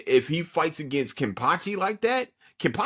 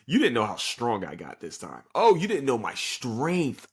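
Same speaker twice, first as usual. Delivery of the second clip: ordinary talk, nothing marked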